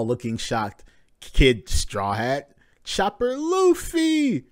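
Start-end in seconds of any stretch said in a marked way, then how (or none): 2.8-4.4 very high stretched squeal of delight; slow high excited squeal; amazed. Real, not acted. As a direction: squeal the name in awe. Awed at the panel.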